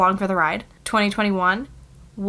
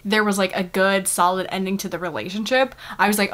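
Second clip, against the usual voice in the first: ordinary talk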